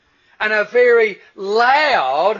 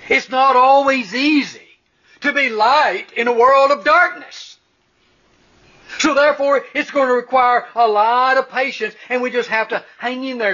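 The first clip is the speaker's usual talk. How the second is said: higher and louder, preaching at full force; rising preaching fervor; 4 semitones higher, 5 dB louder than usual. Real, not acted. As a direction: build the sermon to a ringing climax. Sermon builds to full force.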